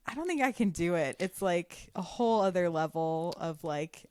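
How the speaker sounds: ordinary talk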